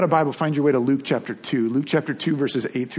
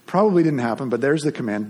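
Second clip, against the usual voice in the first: ordinary talk, nothing marked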